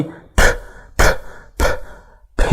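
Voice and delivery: "ب" as an airy English p pop; voiceless P puff. Shown as a demonstration. Teacher returning to the other sound, "ب" as a puffed p. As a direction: lips burst open with only air on "ب". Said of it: "في هوا جامد كده بيطلع".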